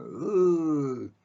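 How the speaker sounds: a high, drawn-out ooh of amazement; crowd's impressed ooh. Retold or imitated. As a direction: sound like a crowd wowed by a shot. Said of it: "everybody went".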